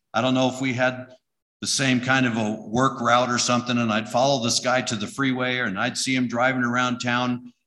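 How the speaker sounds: usual speaking voice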